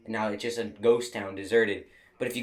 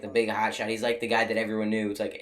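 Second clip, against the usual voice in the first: normal talking voice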